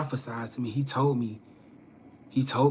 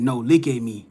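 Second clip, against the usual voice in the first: with authority; 4 dB louder than usual. Retold or imitated. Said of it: "he said it with authority".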